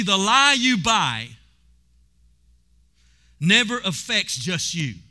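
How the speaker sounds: drawn out and weighty; slow down and drive the warning home; slow emphatic warning. Real, not acted. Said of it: "slow emphatic warning".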